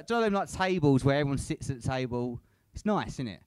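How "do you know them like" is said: usual speaking voice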